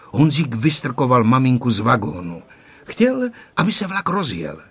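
usual speaking voice

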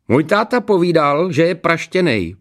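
put-on high, loud boyish taunt; schoolboy piling on. A narrator's reading. Classmate adding his father's verdict, taunting. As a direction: pile on in a high pupil's voice. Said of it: "přisadil si Hromádka".

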